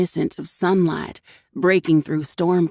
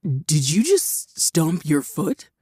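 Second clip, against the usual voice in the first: amused teasing; slower than usual. A narrator's reading. Dante teases Cherry about stomping, slow and amused. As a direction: tease her with a grin. Grin tugged at his mouth.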